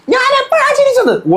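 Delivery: very high, squeaky mock voice, drawn out; shrill-pitched parody of the angry housemate; mocking Rena. Vlogger mocking Rena demanding when she laughed, squeaky high parody.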